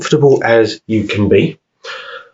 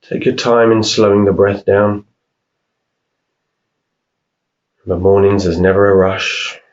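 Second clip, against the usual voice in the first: unhurried meditation voice, long pauses between phrases; guided meditation. Real, not acted. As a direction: calmly guide them through each breath, leaving room to breathe. Leads a breathing meditation.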